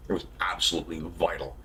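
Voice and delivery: breathless whisper, no voice. Shown as a demonstration. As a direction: whisper the words like a secret. Repeated line dropped to whisper.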